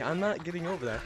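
ordinary talk, nothing marked

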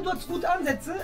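ordinary talk, nothing marked

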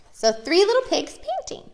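ordinary talk

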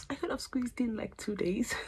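high-pitched wishful aside; second-thought musing. Retold or imitated. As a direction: muse it up high, as a passing regret.

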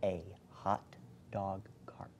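slow, distinct whisper. Shown as a demonstration. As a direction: whisper each word clearly for a lip-reader. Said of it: "who's gonna whisper to me".

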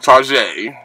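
"Tarjay" as mock-French "tar-ZHAY"; fancy pronunciation. Shown as a demonstration. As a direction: soften the middle of "Tarjay" and end on AY. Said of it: "that's the way you're supposed to say it".

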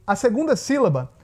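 ordinary delivery

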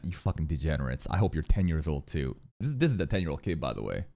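low, quiet muttered contempt; disgusted scolding. Real, not acted. Streamer scolding creepy chat, low disgusted grumble. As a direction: scold the chat under your breath.

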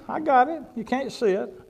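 normal talking voice

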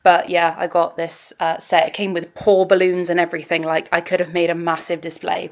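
ordinary delivery

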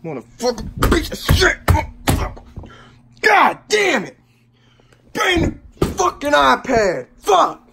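high, cracking furious yells; anguished raging. Real, not acted. Gamer after the iPad breaks, cursing in anguished rage. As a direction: rage close to tears. Ongoing rage, cry heard.

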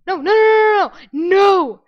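loud, high repeated no; panicked shouting. Real, not acted. Player shouting at an attacker, panicked loud no. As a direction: yell no as you get attacked.

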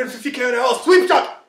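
high, loud mock commentator call; excited commentary. Hyping a fast sweep shot, loud excited call. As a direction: call it like a big sports moment.

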